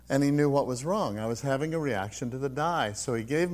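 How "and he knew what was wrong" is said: ordinary talk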